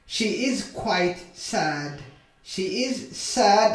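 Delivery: very slow, drawn-out words; slow modelling. Shown as a demonstration. Teacher models a sentence for beginners, very slowly. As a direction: stretch each word for learners.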